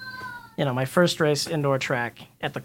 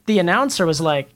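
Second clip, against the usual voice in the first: ordinary delivery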